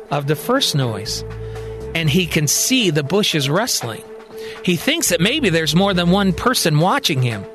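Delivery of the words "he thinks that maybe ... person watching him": ordinary delivery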